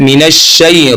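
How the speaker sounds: मीनश शै slow and clear with sh; correct recitation. Shown as a demonstration. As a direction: say मीनश शै the right way. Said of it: "सही कौन सा तरीका है". (सही तलफ्फुज़ से)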